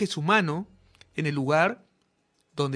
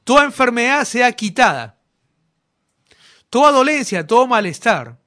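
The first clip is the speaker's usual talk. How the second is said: preaching at full force; 4 semitones higher, 7 dB louder than usual. Acted. Preacher commanding healing over the whole congregation, loud and fervent. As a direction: thunder the order of faith over the crowd.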